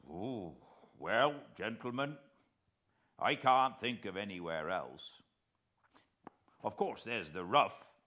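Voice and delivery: lightly voiced naval veteran, eager to help; retired officer answering gentlemen politely; old coast guard man. A narrator's reading.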